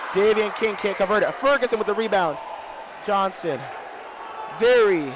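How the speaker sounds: loud excited live game calling; play-by-play. Acted. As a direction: call every pass and shot as it happens.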